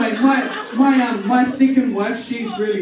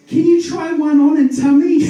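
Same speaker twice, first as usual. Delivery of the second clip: caller's request; 8 semitones higher, 7 dB louder than usual. Retold or imitated. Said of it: "the guy goes".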